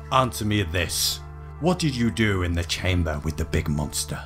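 ordinary talk, nothing marked

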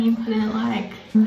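talking around small brush; brush in mouth. Real, not acted. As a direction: lips pulled aside. Seen 0: brush tip held between lips and brackets while talking.

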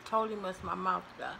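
chewing while talking; talking between bites; mouth full of fries. Real, not acted. Seen 0: Lips pursed around food, fry raised to mouth, chewing before and after.

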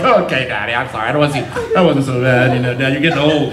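a hint of a contrite little boy turning cheeky; lightly voiced sorry kid. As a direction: play a kid pretending to be sorry. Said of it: "I wanna see a little bit like".

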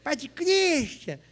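high, slow, drawn-out comic call; woman calling the priest. Acted. Troublesome woman calls out to the priest, high drawn-out call. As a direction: stretch the call out in a mock female voice. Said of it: "essa mulher".

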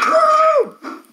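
high whooping cheer; excited cheer. Real, not acted. Cheering fight.

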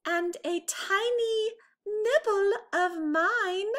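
Mama Bear adding. A narrator's reading. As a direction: chime in as a fussy mama bear. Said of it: "added Mama".

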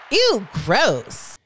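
imitating repulsed ladies; mock disgust. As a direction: exclaim it with swooping pitch, grossed out. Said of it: "And then they go".

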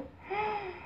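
high, soft gasp; admiring gasp. Real, not acted. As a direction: gasp in wonder. Marvels at how light it is.

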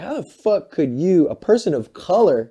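high, loud disbelieving demand; rising outraged how could you; incredulous outrage. Retold or imitated. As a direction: ask it like you can't believe it. Outraged quote to Trump voters.

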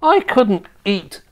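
put-on high, drawn-out horror of a boy; disgusted cry. A narrator's reading. George refusing grandma's slug diet, revolted. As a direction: wail the refusal in a high child voice. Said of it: "George cried out".

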